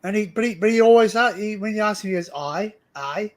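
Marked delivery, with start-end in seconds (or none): 2.4-3.3 attempted Scottish accent. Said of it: "I can't do it".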